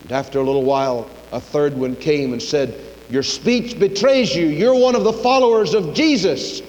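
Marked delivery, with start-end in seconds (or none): 3.1-6.6 high, emphatic accusing voice; acted accusation. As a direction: press the charge with raised pitch. Third accuser confronts Peter.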